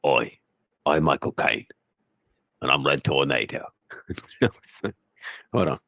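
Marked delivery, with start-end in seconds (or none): 0.1-3.7 Michael Caine impression. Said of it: "Let me try that Michael Caine bit".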